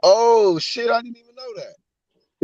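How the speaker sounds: surprised. Real, not acted. Learns Boxing Antics is Finesse.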